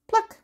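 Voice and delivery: high popping pluck; plucking sound. Shown as a demonstration. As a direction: pluck like a flower.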